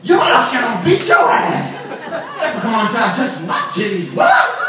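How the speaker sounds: loud, high-pitched indignant shouting; mock outrage. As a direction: imitate her yelling in hammed-up fury. Acted outraged woman after slapping hand.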